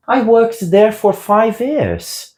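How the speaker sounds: slow, high, clear English example; slow model sentence. Shown as a demonstration. Teacher modelling an English sentence slowly for learners. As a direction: lift it up and lay it out slowly.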